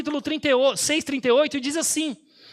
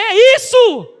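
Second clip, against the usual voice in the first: higher, louder, far more excited "É isso"; excited cheer; 6 semitones higher, 8 dB louder, much slower than usual. Retold or imitated. Pastor cheering the patient filled with the Spirit, excited. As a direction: cheer it out in triumph.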